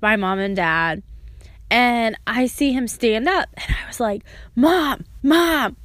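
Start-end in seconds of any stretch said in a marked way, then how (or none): 4.5-5.8 higher, insistent calls to mother; urgent calling. Retold or imitated. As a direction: get mother's attention, pitched up. Child calling mother in church.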